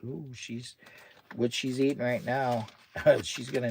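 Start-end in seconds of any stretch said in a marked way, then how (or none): 0.0-0.8 soft whispered aside. Real, not acted. Owner whispering while his dog eats. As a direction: hush so the dog isn't disturbed.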